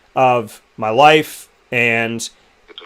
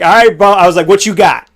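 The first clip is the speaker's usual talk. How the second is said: louder, higher, fired-up call-out; hyped challenge; 4 semitones higher, 6 dB louder than usual. Retold or imitated. Singer challenging [name] to a riff, louder and fired up. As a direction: dare him to bring it.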